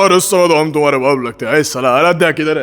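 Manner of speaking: low-pitched film-star swagger; deep-voiced Bollywood patriarch; Amitabh Bachchan. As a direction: drop the voice low for a movie hero. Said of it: "Amitabh Bachchan Saheb".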